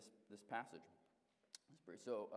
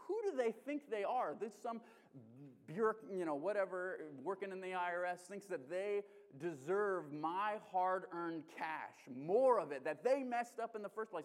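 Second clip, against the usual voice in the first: higher, louder mock-furious rant; retold anger; 5 semitones higher, 5 dB louder than usual. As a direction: replay the indignation, hammed-up. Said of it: "very angry".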